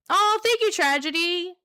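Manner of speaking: high, loud sugary mock gratitude; mock gushing thanks. Streamer mock-thanking a chatter in a high gushing squeal. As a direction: gush it out in a put-on squeal.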